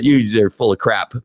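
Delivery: ordinary talk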